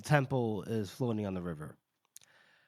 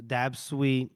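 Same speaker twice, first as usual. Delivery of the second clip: ordinary talk, nothing marked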